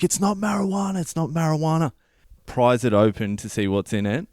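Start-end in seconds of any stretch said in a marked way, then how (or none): 0.0-1.9 high-pitched, insistent protest; frantic denial. Retold or imitated. Officer grabs his hand, he protests, frantic. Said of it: "I'm going off my head".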